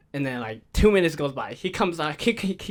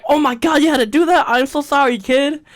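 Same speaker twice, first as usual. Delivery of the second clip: loud, high, laughing disbelief; shocked apology; 8 semitones higher, 8 dB louder than usual. Retold or imitated. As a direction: react with mock horror and sympathy. Retold shocked apology to little brother.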